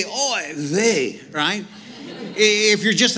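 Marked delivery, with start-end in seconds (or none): 0.0-1.2 a light Yiddish-flavoured "Oy vey"; Yiddish exclamation. Retold or imitated. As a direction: toss off "Oy vey" with a Yiddish lilt.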